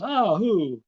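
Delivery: a sudden, high and loud "Oh, who?"; surprised. Retold or imitated. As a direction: jump on the news, caught off guard. Friend hears surprising wedding news.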